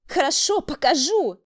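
high, irritated retort; irritated bark. A narrator's reading. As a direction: give in with an angry bark. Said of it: "Раздражённо рявкнула Тамара". (раздражённо рявкнуть)

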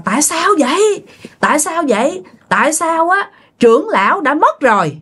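put-on high, hoarse ghost of an old man; hoarse old man spirit. Possessed aunt demanding answers from the family, hoarse old man voice. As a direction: let a trapped old spirit rasp through the aunt. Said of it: "giọng khào khào, giống như là giọng của một ông già".

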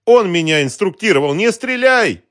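high-pitched, loud, panicked confession; terrified shouting. A narrator's reading. Captive blurting out a name at gunpoint, panicked shout. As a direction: plead for your life at the top of your voice. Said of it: "бледнее закричал Роман".

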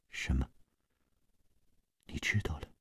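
a shocked near-whisper that rises to a question; stunned, hushed. A narrator's reading. Gong Nanyan, secret exposed by Yin Ji, shocked and hushed. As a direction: caught off guard, breath gone out of the words. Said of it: "宫南雁愣了愣，脸色渐渐地变了".

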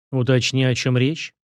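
usual speaking voice

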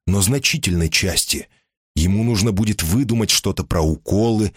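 plain reading voice

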